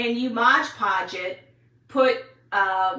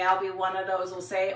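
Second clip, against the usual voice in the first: ordinary talk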